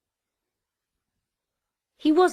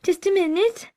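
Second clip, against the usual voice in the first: high, loud call from the landing; a sister calling down to her brother; 12 semitones higher, 7 dB louder than usual. Acted. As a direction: call down the stairs, much higher. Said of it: "Miss Evans called from the landing".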